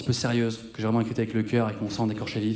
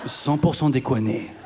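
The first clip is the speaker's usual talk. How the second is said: mock advert; slower than usual. Mock ad jingle.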